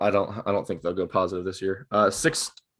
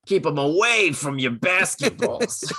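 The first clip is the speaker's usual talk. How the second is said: higher put-on cartoon voice; goofy character voice; 7 semitones higher than usual. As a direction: play a goofy character, pitched up. Joking Coach Steve impressions traded.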